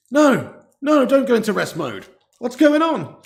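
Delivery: high, loud flustered plea; sudden fluster, pitch leaping up; panicked protest. Real, not acted. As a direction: cry out at a device that's failing. Alarmed at screen going into rest mode.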